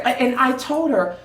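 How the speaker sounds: usual speaking voice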